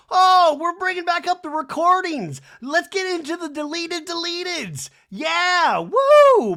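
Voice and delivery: very high, loud hammed-up cheer; mock hype. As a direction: whoop it up like gossip is back. Hyping the return of recordings.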